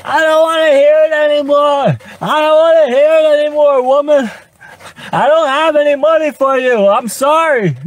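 high-pitched frantic yell; yelling at his car to leave him alone. Acted. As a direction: shriek it at the car, fed up.

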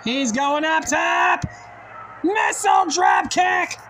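excited play-by-play call; wrestling commentator. Acted. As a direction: call the big moves live.

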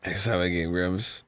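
very low, soft rumble; low echo. Pretend. Co-host echoing the sign-off, very low and soft. Echoes the sign-off far lower.

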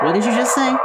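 sudden high disbelieving question; shocked. Acted. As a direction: recoil at what you just heard. Shocked reply in a game quarrel.

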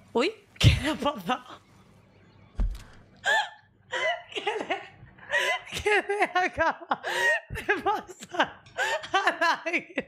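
high, giggling question; real giggly disbelief; laughing disbelief. Real, not acted. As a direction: laugh through the words. Eagle suddenly dies, laughing.

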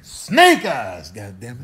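normal talking voice

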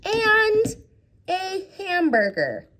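high, bright build-up to a hamburger; excited. A narrator's reading. Teacher teasing the kids with the story's items, excited. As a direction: sell the hamburger like a treat.

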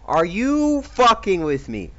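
loud, high furious rant; raging gamer. Real, not acted. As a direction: rage at the screen. Raging at game character.

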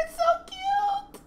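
very high squeak through laughter; tearful giggly squeal. Real, not acted. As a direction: squeak it out, overcome by cuteness. Gushing over baby pictures.

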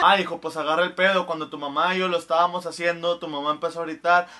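deep-voiced father answering his son. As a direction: explain like an embarrassed dad. Father explaining.

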